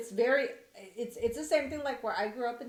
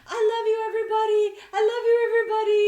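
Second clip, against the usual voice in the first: high, loud, fast sing-song gushing; husband mocking Americans' fake friendliness, high and loud; 11 semitones higher, 12 dB louder, much faster than usual. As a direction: overdo the fake cheerful love. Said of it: "he was like".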